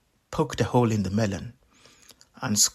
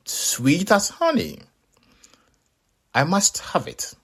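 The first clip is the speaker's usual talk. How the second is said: high, eager Anansi voice; high greedy trickster voice; greedy spider; 8 semitones higher than usual. Acted. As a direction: squeak it out, already hungry. Anansi covets the melon.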